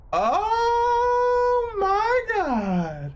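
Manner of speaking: long high shocked "oh my God" wail; stunned viewer wailing at the screen; drawn-out wail. Real, not acted. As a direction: stretch it out in horror, way up high. Shocked reaction to gory scene.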